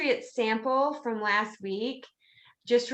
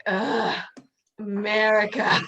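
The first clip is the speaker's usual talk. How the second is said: mock disgusted Brit; 2 semitones lower than usual. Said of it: "the British are like".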